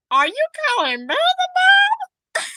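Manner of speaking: very high, loud mock outrage; hammed-up, high-pitched indignant question; exaggerated disbelief. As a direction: squeal it in over-the-top shock. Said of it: "I didn't say it like that".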